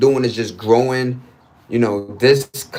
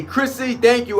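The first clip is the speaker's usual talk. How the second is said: excited shout-out; 11 semitones higher than usual. Real, not acted. Shout-out to viewer.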